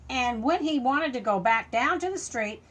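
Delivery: ordinary delivery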